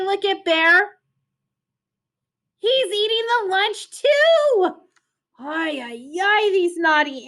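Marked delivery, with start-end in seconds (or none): none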